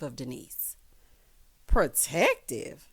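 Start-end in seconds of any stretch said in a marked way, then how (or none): 1.7-2.9 very slow, drawn-out scoff; slow sarcastic echo; scoffing disbelief. Real, not acted. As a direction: drag the word in disbelief. Scoffing at Rena's word.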